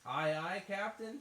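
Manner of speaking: put-on high, rising chorus-of-kids answer; SpongeBob kids' reply. Telling a friend the show's response, kids' call. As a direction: answer the captain like eager cartoon kids. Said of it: "from SpongeBob".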